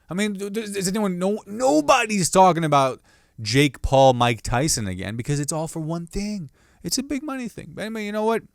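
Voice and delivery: pitched high, rushing rant; worked-up rant. Real, not acted. Ranting about big-money fights, high worked-up voice.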